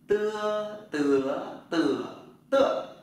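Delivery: "tưa" said through four tones, loud, first one high; Chinese tone drill. Shown as a demonstration. Teacher drilling students on "tưa". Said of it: "Chữ giờ là chữ tờ nhé".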